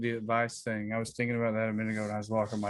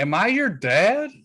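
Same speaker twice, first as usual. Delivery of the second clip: mock fatherly; 10 semitones higher, 7 dB louder, slower than usual. Teasing host as his dad.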